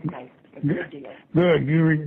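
ordinary talk

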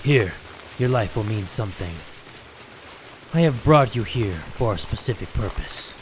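low, measured voice-over; low and deliberate; solemn game character. Acted. Reads character's lines.

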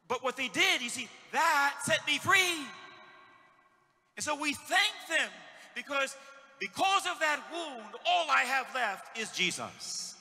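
loud, rising sermon delivery; ringing pulpit call to thank them; preaching at full force. Acted. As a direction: build it up like a revival preacher. Preacher builds to a climax.

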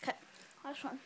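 ordinary delivery

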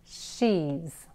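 soft hushed "She's" with a gentle SH; English she's. Shown as a demonstration. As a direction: breathe "She's" softly, tongue free. ("She's" suave y quedo)

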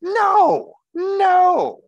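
very high, loud double no; emphatic no. Real, not acted. Rejecting the idea of Jesus as a power grabber, loud emphatic no. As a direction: shoot the idea down hard.

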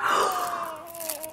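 sharp, high gasp of delight; excited gasp. Real, not acted. At the child's present being revealed, excited gasp. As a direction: gasp at a wonderful surprise.

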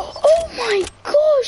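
high, drawn-out amazed gasp; excited. Real, not acted. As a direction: stretch it out in awe. Teammate carrying, big win.

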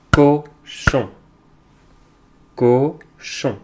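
Cochon split into co and chon, first beat punched; segmented Cochon with a gap after a strong co; syllable by syllable. Shown as a demonstration. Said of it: "on va frapper les syllabes".

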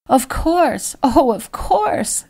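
high, drawn-out motherly coo; doting, high-voiced fussing. A narrator's reading. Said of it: "Lady Thornton cooed".

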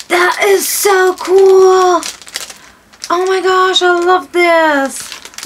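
high, long-drawn squeal of delight; thrilled gushing. Real, not acted. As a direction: stretch the words out in high-pitched joy. Opens second present, thrilled.

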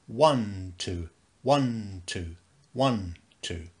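march count leaning on "one"; stress on one. Shown as a demonstration. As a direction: count "one, two" with a strong accent on "one". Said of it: "let's try to say".

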